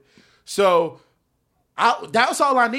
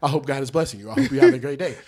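low, soft polite greeting; courteous well-wisher; 8 semitones lower, 3 dB quieter than usual. Retold or imitated. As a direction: lightly act polite to a stranger. Imitating politeness to a random stranger.

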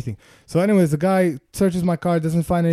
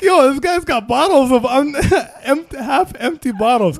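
laughing through the words; chuckling mid-sentence; 8 semitones higher, 6 dB louder than usual. Real, not acted. Laughing while quoting.

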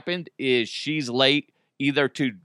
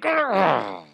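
flexing grunt; 4 semitones higher than usual. Retold or imitated. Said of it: "I'm like".

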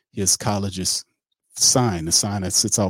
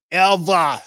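ever called out much higher and louder, falling at the end; rising loud ever; 11 semitones higher, 8 dB louder than usual. Acted. Telling the story, the last ever belted high and loud.

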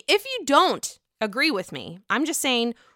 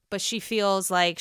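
normal talking voice

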